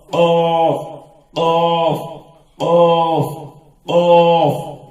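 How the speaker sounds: loud, held "قاف" with a back-of-mouth q; thick, rounded vowel in "قاف"; heavy letter. Shown as a demonstration. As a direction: repeat "قاف" full and level, drawn out. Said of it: "یہ بھی موٹا حرف ہے".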